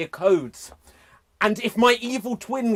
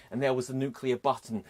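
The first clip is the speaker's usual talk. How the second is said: ordinary talk, nothing marked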